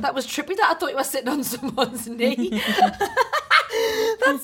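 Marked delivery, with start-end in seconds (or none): 2.5-4.2 high hooting laugh like a chimp. Real, not acted. Laughing at a friend's odd trousers, chimp-like hoot. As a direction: let out a shrieking chimp cackle. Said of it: "Sounded like a chimp when I laughed".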